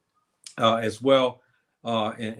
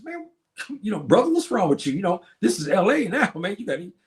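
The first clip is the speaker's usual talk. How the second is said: high, giggling through the words; retelling a joke through real laughter; laughing tease; 7 semitones higher than usual. Retold or imitated. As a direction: tease your friend while cracking up. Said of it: "I would laugh at him".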